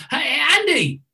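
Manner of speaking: high, sing-out greeting; friendly call. Retold or imitated. Friendly man calls to the speaker beside him, warm hail. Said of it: "a friendly voice calls".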